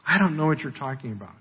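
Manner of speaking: evasive dodge. Acted. Peter dodging the servant girl's question, quick evasive aside. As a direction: brush the question off quickly and under-played. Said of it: "He just dodges the question".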